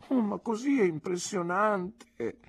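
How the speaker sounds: high, slow, dismayed exclamation; Dora exclaiming. A narrator's reading. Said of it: "esclamò Dora". (esclamazione sgomenta, alta e lenta)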